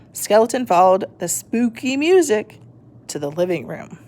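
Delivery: usual speaking voice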